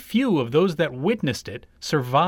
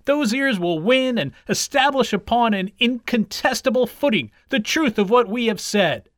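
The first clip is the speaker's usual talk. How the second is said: higher-pitched, oratorical declaration; confident proclamation; 7 semitones higher, 3 dB louder than usual. A narrator's reading. Hare's friends as he runs on, proclaiming. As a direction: declare it like a partisan speechmaker.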